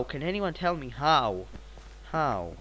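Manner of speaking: usual speaking voice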